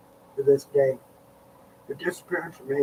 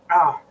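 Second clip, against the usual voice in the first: pained groan; 3 semitones higher, 6 dB louder than usual. Real, not acted. Back pain, groaning.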